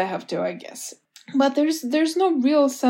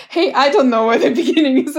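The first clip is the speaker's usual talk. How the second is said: louder, fast, laughing mock of the complaint; mocking echo; 3 semitones higher, 7 dB louder, faster than usual. Author mocking publishers' feedback, fast laughing echo. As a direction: throw the complaint back, half laughing.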